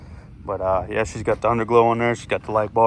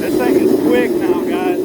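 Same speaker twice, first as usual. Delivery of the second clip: louder and higher, thrilled; excited; 7 semitones higher, 5 dB louder than usual. Real, not acted. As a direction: call it out pumped up. Excited at speed.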